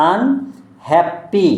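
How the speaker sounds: আনহ্যাপি very slowly, আন, হ্যাপ, পি; sounded out in parts. Shown as a demonstration. Teacher spelling for a pupil, আনহ্যাপি drawn out part by part. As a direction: break আনহ্যাপি into separated chunks. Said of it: "তাহলে U N".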